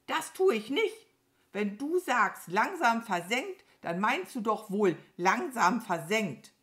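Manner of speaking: hohe, laute, rechthaberische Krähe; empört auf dem Wortlaut beharren; schimpfende Krähe. Acted. (zeternd, hoch und laut)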